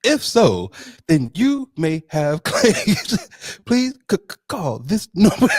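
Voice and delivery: loud, high TV-ad pitch breaking into laughs; lawyer ad voice. Pretend. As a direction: hype it like a late-night claims ad. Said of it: "mesothelioma, myeloma commercials".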